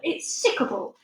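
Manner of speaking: a hint of a giant, grossed out then pushing it on her; lightly voiced disgusted giant. A narrator's reading.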